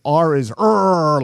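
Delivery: second "R" a loud, held "errr"; tiger-like R. Shown as a demonstration. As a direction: growl out the final "R" hard. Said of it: "like a tiger".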